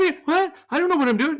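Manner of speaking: very high, loud flustered panic; panicked. Retold or imitated. Simon realizing he signed up, high panicked squeal. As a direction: squeal in disbelief and alarm.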